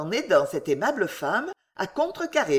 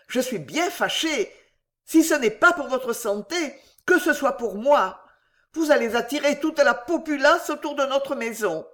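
higher-pitched pleading husband; heated pleading; 6 semitones higher than usual. A narrator's reading. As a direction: press the plea with mounting urgency. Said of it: "mettait de chaleur dans ses supplications". (supplication pressante d'un mari, voix plus aiguë)

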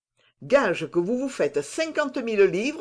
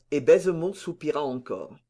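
usual speaking voice

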